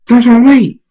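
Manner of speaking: high, loud cry to stop; pleading shout; urgent call. Acted. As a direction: call after someone charging off. Friend calls to stop him.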